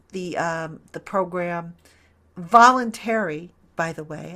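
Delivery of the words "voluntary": voluntary hit loud and drawn out slowly; stress on voluntary. Shown as a demonstration. Host stresses that Kadarius Toney's program is voluntary, voluntary punched loud and slow. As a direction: lean hard on voluntary, slow and louder. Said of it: "I want to stress that word again".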